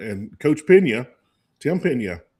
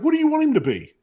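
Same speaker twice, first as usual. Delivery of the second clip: much higher, frustrated question; exasperated plea. Retold or imitated. Dazed player pleading with the coach, high and exasperated. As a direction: beg for the right answer. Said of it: "I was like".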